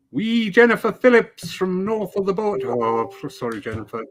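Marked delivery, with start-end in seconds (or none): 0.1-2.7 put-on playful, higher-pitched Scottish accent. Host greeting a viewer from north of the border, Scottish accent. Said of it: "never to do the Scottish accent again".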